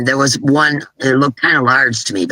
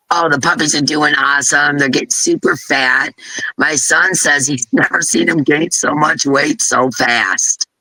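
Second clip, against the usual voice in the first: ordinary talk, nothing marked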